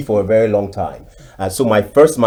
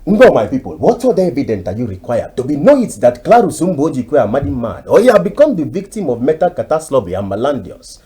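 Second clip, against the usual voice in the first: higher, louder pompous chief with long words; Chief Zebudiah impression; 5 semitones higher, 4 dB louder than usual. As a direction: boom it out like a grand village chief. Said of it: "Chief Zebudiah will, will say".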